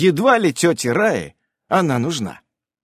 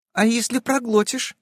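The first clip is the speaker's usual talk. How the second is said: put-on curious girl; 11 semitones higher than usual. A narrator's reading. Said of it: "интересуется Катька".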